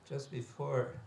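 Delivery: ordinary talk, nothing marked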